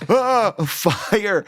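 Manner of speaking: fake surprise. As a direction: act like you just noticed the fire, drawn out and high. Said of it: "and I'm like".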